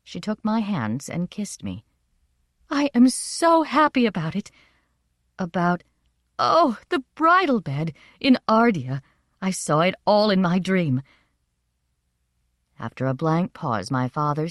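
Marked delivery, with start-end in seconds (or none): 2.7-11.0 high, gushing delight that settles slightly; excited happiness. A narrator's reading. Mother telling her daughter of a dreamed wedding, happily excited. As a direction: gush over it like a joyful dreamer. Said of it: "flushed and beautiful in her excitement".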